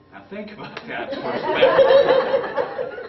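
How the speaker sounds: can't hold back the laugh. Real, not acted. Laughing at the age gap.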